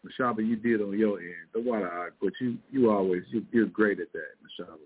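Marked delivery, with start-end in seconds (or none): none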